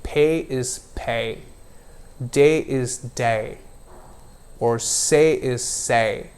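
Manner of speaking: slow contrast, flat Indian vowel then gliding American diphthong; Indian versus American. Shown as a demonstration.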